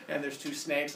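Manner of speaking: usual speaking voice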